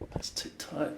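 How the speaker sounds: breathy unvoiced aside to himself; whisper. Real, not acted. Adjusting his gear, he whispers to himself. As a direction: whisper it under your breath.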